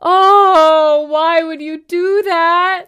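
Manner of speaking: loud, high, drawn-out lament; mock anguished wail. Co-host reliving Will's gut punch, mock anguished wail. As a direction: wail it slowly, hammed-up and pained.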